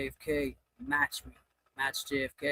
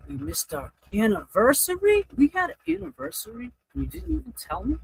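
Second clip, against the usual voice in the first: mock outrage; 6 semitones higher, 4 dB louder than usual.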